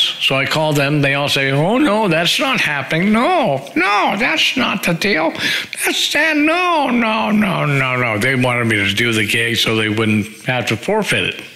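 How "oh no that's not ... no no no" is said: high, rising chorus of no's, hammed up; mock refusing bandmates. Bandmates on the phone refusing him, high mocking voice. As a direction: squeal the no's higher and higher, mocking.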